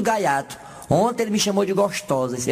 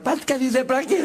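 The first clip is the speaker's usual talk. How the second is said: mock incredulous retort; 5 semitones higher than usual. Mocking retort.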